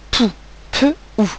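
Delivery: "pou" whole, then split as "Peu ou"; sounding out. Shown as a demonstration. Teacher isolating the first syllable for pupils, sounding out. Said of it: "première syllabe".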